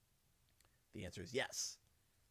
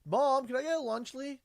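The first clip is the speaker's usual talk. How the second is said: put-on high, pleading kid voice; lightly voiced child begging; kid asking; 11 semitones higher, 5 dB louder than usual. Child asking mom for a snack, in a high pleading voice. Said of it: "the kid goes".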